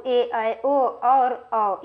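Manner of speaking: slow, each vowel ए ऐ ओ औ held long; drawn-out vowels. Shown as a demonstration. Teacher demonstrating two-beat long vowels. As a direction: hold every vowel ए, ऐ, ओ, औ out slowly. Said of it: "इनकी संख्या सात है।".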